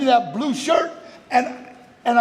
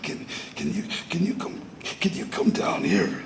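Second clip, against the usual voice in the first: scared kid voice, barely voiced and much softer; hesitant, halting plea with almost no voice; timid near-whisper; 9 dB quieter than usual. Scared son begging dad to come, almost whispered.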